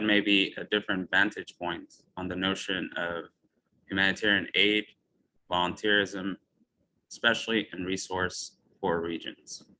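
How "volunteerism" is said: usual speaking voice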